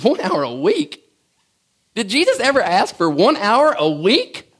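ordinary talk, nothing marked

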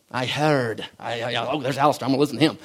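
light mock Scottish accent, low and soft; a hint of Scottish preacher brogue; gently imitating a Scottish accent. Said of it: "like, Scottish accent".

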